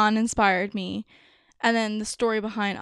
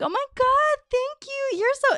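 high, gushing, bubbly thank-you; 13 semitones higher than usual. Retold or imitated. Someone complimented, gushing back in a high voice. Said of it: "it's like".

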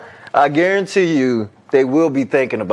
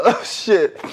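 ordinary talk, nothing marked

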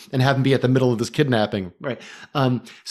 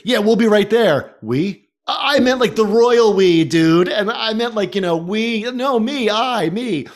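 imitating the Dude; 7 semitones higher, 3 dB louder, slower than usual. Quoting the Dude flustered.

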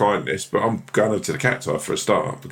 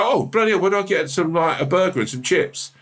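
pitched-up exasperated visitor; flustered lost tourist; 9 semitones higher than usual. Retold or imitated. Lost Brit in London asking strangers for chips, high and flustered. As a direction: play the baffled tourist, voice jumping high. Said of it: "I wanna go".

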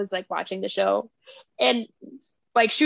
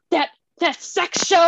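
slow, higher scandalised stammer; shocked dad; 5 semitones higher than usual. Retold or imitated. Dad on the phone about Bridgerton, shocked. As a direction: imitate a dad stumbling over the words. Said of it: "like, so shooken".

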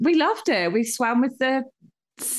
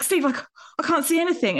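high, quick mock alarm; retold panic; 8 semitones higher, faster than usual. Swimmer calling to partner in the sea, mock panicked. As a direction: call for help, stumbling in mock panic.

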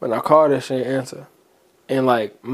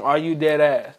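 ordinary delivery

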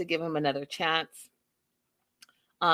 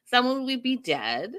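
normal talking voice

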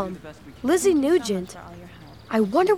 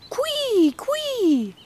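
high squeaky bird cry; bird call; 9 semitones higher than usual. Retold or imitated. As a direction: chirp it out high like a small bird. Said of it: "have you ever heard a bird like this".

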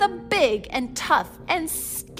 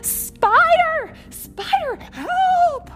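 very high, squealing alarm; panicked cry; 7 dB louder, much slower than usual. A narrator's reading. Giant dinosaur spotting a spider, panicked high cries. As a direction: shriek for help in mock terror.

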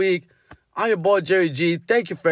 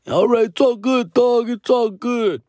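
higher, drawn-out tipsy guy; mock drunk reassurance, pitched up; drunk fan impression; 5 semitones higher, slower than usual. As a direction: imitate a drunk fan shrugging it off. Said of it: "They were kinda drunk".

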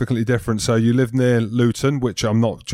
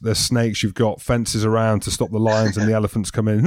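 ordinary talk

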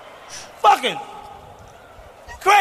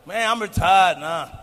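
ordinary delivery

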